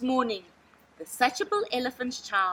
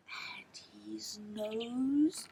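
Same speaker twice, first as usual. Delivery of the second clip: plain reading voice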